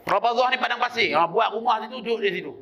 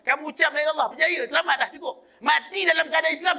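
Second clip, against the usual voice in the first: high-pitched, driving sermon delivery; fervent preacher pressing the point home; impassioned preaching; 8 semitones higher than usual. Acted. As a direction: preach it with raised, insistent pitch.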